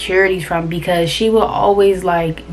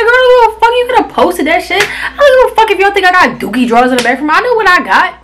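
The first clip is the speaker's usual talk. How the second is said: sassy comeback; 8 semitones higher than usual. Retold or imitated. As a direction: fire it back, high and dismissive. Retold comeback to rival's comment.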